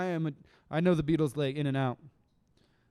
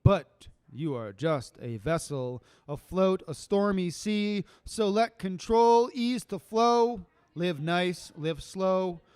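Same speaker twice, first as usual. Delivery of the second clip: slow, rhythmic poetry reading; measured spoken word delivery; spoken word poem; 4 semitones higher, 4 dB louder, slower than usual. Acted. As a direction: lay each line down with weight.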